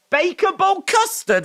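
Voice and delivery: very high, loud squeal. Real, not acted. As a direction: squeal the flavor name in disbelief. Shocked reading of odd flavor.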